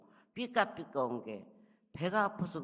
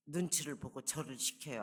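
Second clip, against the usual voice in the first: ordinary talk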